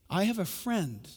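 ordinary talk, nothing marked

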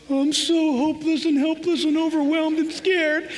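mock whiny voice. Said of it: "'cause you're all".

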